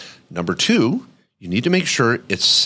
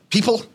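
higher, emphatic call to the audience; direct address; 11 semitones higher than usual. Shown as a demonstration. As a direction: look them in the eye and call out "People.". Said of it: "look 'em in the eye right now and say".

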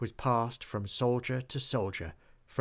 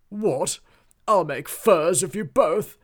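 high, loud outraged threat; furious shout; 7 semitones higher, 11 dB louder than usual. A narrator's reading. Duke threatens his cats, shouting in outrage.

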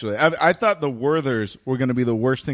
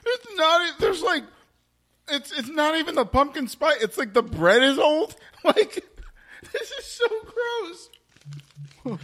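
disgusted laughing; 12 semitones higher than usual. Real, not acted. Tasting a gross bar.

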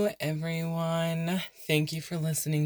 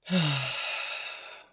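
long, falling exhaled sigh; demonstrated sigh. Shown as a demonstration. Meditation guide modelling the out-breath, long sigh. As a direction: breathe out audibly and let it fade. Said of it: "You can even add a sigh".

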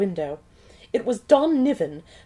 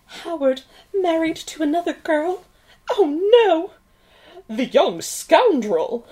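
put-on old-movie gasp, then slow scorn; melodramatic outrage; 5 semitones higher than usual. Hammed-up heroine outrage.

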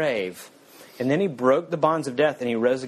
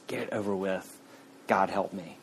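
quiet, defeated giving in; resigned surrender; 4 semitones lower, 7 dB quieter than usual. Acted. Ghost finally giving the angel permission, quiet and defeated. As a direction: let it go, much softer.

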